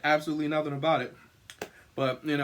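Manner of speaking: ordinary delivery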